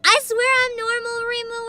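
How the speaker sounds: high-pitched, loud plea; embarrassed high-voiced protest; flustered protest. Real, not acted. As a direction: insist to a friend, voice pushed up high. Flustered protest to arriving friend.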